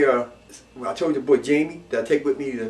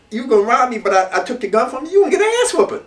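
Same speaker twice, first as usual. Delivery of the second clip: mock threat; 7 semitones higher, 3 dB louder than usual. Voicing the robbed man's warning.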